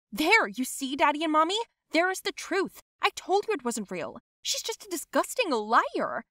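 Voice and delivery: high, heated appeal to parents; indignant, high-pitched I-told-you-so; vindicated accusation. Acted. Triumphant accusation before parents.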